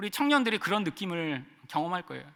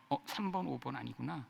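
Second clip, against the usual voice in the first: muttered checking; 8 semitones lower, 13 dB quieter than usual. Retold or imitated. Mentally checking a list.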